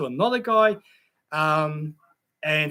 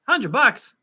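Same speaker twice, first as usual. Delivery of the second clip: higher, punched-out surprise; surprised exclamation; 7 semitones higher, 4 dB louder than usual. Real, not acted. Streamer reacts to a hundred bucks, exclaiming in surprise. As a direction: blurt the amount out in surprise.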